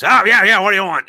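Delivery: put-on loud, high gruff old pirate voice. Old pirate loudly answering the nervous young sailor. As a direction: bark it out like a crusty sea dog. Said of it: "And he goes".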